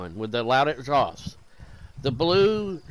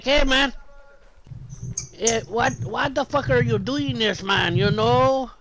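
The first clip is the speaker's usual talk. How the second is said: high, loud mock protest; indignant ranting man; 7 semitones higher, 4 dB louder than usual. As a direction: imitate a worked-up guy objecting. Acted protest of retold man.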